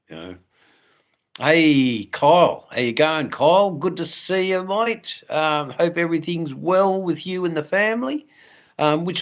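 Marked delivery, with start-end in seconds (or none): 1.4-7.6 calling out, higher and louder; light up at a friend arriving in chat; big happy hello to Kyle; excited greeting. Real, not acted.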